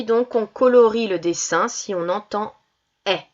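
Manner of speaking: ordinary delivery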